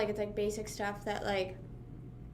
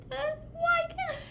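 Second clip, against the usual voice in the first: high, strained whimper of frustration; frustrated whine. Retold or imitated. Retelling her teary pickleball frustration, a high strained whine. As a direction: whine it out, close to tears. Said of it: "it's frustrating".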